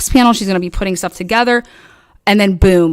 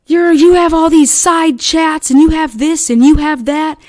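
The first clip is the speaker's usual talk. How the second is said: Lynn; 7 semitones higher, 3 dB louder than usual. Retold or imitated. Lynn confronts others about side chats, accusing. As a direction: accuse loudly, pitched well up, listing each charge. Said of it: "and Lynn's like".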